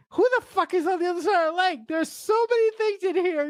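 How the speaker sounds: high, baffled outburst about the plot; incredulous cry. Retold or imitated. Podcast host voicing a confused viewer, high incredulous. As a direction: throw up your hands in disbelief.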